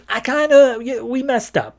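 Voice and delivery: high, sheepish mumble of a guilty henchman; sheepish whiny voice. Retold or imitated. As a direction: squeak it out like you know you blew it. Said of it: "the other one's just like".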